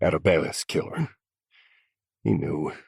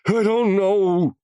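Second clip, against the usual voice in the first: sobbing denial; 7 dB louder, slower than usual. A narrator's reading. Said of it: "sobbing like a pussy".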